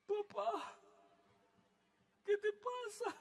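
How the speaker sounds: put-on high, soft child asking; a small boy worried about his dad; little son's voice. Pastor voices his small son asking his father. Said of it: "llegó".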